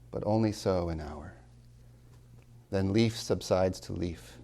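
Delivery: slow recital, fading to a whisper at the end; poem reading. Acted. Professor reciting Frost to the seminar, slow and fading.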